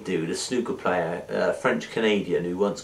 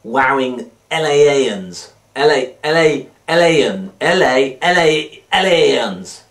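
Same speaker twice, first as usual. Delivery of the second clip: hyped announcement; 4 semitones higher, 8 dB louder than usual. Real, not acted. Hyping the LA guest.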